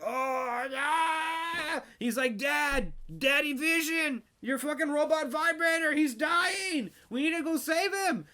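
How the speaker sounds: put-on high excited yelling kid; excited kid shout. Kid yelling to mom that dad is dying, high excited shout.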